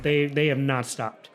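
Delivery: ordinary delivery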